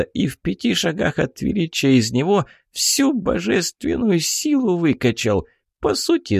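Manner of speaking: high, lively pixie voice; a tiny fairy telling wild news. Acted. Pixie tells a story.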